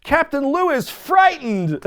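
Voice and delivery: deadpan the call; announcer voice. Retold or imitated. Quoting Craig DeGeorge calling it, slow mock announcer. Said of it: "Craig DeGeorge says".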